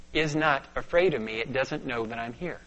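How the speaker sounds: ordinary talk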